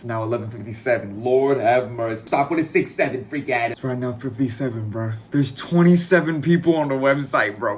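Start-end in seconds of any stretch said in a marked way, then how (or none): none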